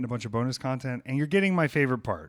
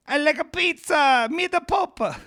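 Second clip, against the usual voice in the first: mock Italian accent; 7 dB louder than usual. Asked by a friend for an Italian accent, a hammed-up high Italian accent. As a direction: overdo a cartoon Italian voice. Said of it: "An Italian accent".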